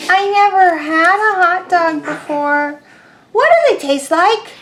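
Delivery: high, small duckling voice; a tiny curious duckling. Acted. Teacher voicing the duckling asking Pigeon, high and small.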